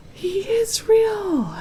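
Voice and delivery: high, slow, awestruck; amazed. A narrator's reading. Santa seeing Harold at last, awestruck. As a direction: let the wonder stretch out.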